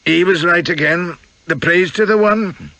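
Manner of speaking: put-on high-pitched Scots accent; Peitingi's astonishing accent. A narrator's reading. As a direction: proclaim it in a Scottish accent. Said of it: "in that astonishing accent".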